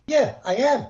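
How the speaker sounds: retold angry reply. Angry umpire answers the president.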